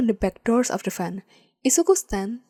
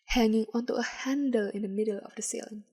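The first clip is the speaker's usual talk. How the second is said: ordinary delivery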